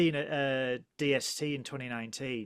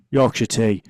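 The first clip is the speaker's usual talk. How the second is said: put-on Yorkshire accent; 5 semitones lower, 12 dB louder than usual. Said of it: "in a Yorkshire accent as well".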